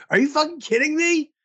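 mock outraged disbelief; retold outburst.

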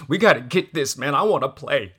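half-laughing imitation of his own crying; retold tearful plea. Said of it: "I started crying".

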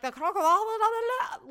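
trailing into la-la nonsense on "croque-"; mock French. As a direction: fake your way through a French word. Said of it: "I'm like".

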